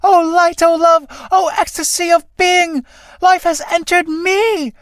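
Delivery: rapturous. Acted. Newborn moth greeting light and life, rapturous declamation. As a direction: declaim in wonder, voice held high.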